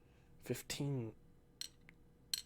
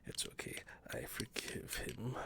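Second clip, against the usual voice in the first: whisper. Real, not acted. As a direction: breathe the words slowly. Whispered forgiveness, slowed.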